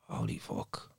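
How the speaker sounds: hushed, whispered swear to oneself; barely voiced retold disbelief; whispered shock. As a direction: whisper it under the breath, stunned. Retold private shock at finished house.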